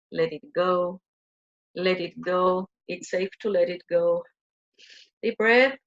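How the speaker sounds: gentle guided-meditation chant; soothing guided release. Acted.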